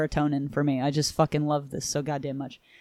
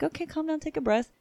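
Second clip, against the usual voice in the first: higher, softer soothing mentor; mock calming; 10 semitones higher, 6 dB quieter than usual. Host voicing the mentor calming his pupil, soft and high. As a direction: gently talk her down, hammed up.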